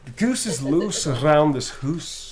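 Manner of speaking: slow Scottish accent with rolled R; slowly, rolling the R in "around"; a Scottish accent demo with a rolled R. Shown as a demonstration. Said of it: "rolling the R's really helps".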